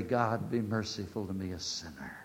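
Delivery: bow the voice in penitence; hushed sinner's plea; humble prayer. Acted.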